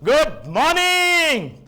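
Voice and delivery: loud, high, ringing good morning; good morning called out bright and loud; enthusiastic greeting. Shown as a demonstration. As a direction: greet the hall with full energy. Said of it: "लेकिन मैं बोला".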